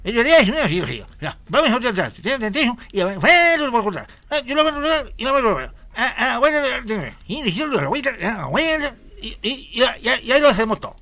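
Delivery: comic neighbour babbling instructions, half laughing; imitating the Ñungo. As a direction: rattle off words nobody can understand. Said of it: "Voy a tratar de imitarlo".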